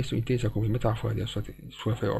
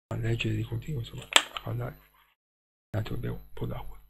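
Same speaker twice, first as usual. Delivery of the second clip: dry, tired throat from reading; talking with a parched throat; 4 dB quieter than usual. Real, not acted. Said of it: "non mi si secca la gola".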